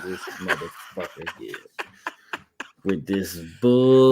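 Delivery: low, mumbled disgust through a laugh; mock exasperation. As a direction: groan at the joke teller. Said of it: "I was like".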